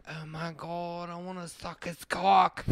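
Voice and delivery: very soft, slow mock swoon; swooning women. Women lusting after the skateboarder, hushed mock swooning. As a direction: sigh it out slowly like a besotted fan. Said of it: "And they're like".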